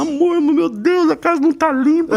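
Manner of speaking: high-pitched, drawn-out dismay; hammed-up domestic panic; mock panicked wife. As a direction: wail it like a flustered wife. Wife panics about the dirty house.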